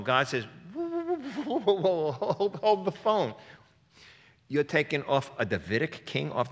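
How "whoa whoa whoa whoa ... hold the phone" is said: alarmed whoa. Pretend. God halts the plotters' plan.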